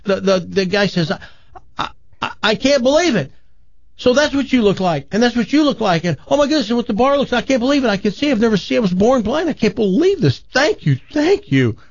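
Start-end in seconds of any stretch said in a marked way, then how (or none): 1.1-11.8 much higher, stammering with wonder; amazed blind man. Acted. Healed blind man to the bar, higher, overwhelmed with wonder. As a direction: gush like you're seeing for the first time.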